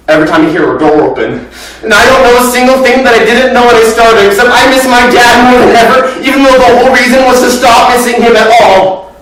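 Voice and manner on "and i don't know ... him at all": loud, high, desperate grief; climactic, high-pitched wail of words; anguished outburst. Acted. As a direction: cry out how much the loss aches. Climax, misses dead father, acted.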